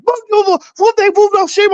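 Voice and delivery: high-pitched gibberish French tirade; very high, mock-hysterical French babble; mock French yelling. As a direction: imitate a woman screaming in fake French. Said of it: "she starts yelling and screaming in French".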